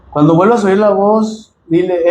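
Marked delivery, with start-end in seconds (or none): none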